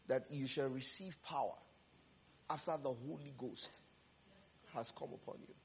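a soft low sermon murmur; hushed reverent preaching. Acted. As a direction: let it sink to a near hush.

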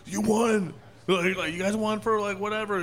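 retold excitement. Retelling a friend's call about the win, excited high voice. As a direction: act out breaking the big news.

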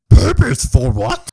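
high, slow Yoda croak. Acted. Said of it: "talking to Doctor Yoda".

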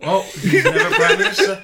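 high, loud incredulous laugh through the words; laughing disbelief. Real, not acted. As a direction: burst out laughing in protest. Laughing disbelief at a silly answer.

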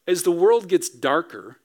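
normal talking voice

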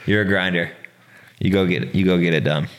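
normal talking voice

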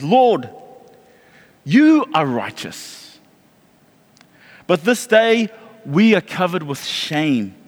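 slow, drawn-out prayer words; solemn prayer reading. Acted. Pastor voicing Daniel's prayer to the Lord, slow and solemn. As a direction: read it like a plea to God.

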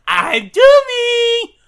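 loud, high shouted name; shouted intro. Acted. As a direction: bellow your name into the mic.

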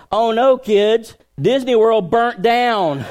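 loud, slow, high mock announcement of disaster; dad breaking fake bad news, hammed up; drawn-out pretend alarm; feigned dismay. Dad feigns disaster to his kids.

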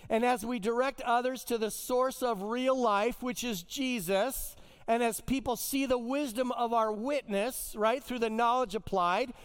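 rising, emphatic sermon build; preaching. Acted. Preaching the application.

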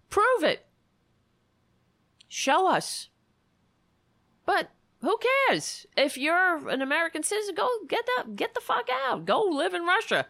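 very high-pitched, scornful dare; high, heated sarcastic retort; exasperated challenge. Acted. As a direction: fire it back high and incredulous. Heated retort to would-be emigrants.

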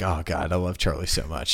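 soft, low gushing fan voice; swooning fan. Retold or imitated. Mocking smitten fan.

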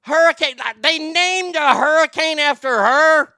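can't believe what you just read; incredulous outburst. Real, not acted. Host reacting to a hurricane's name, loud disbelief.

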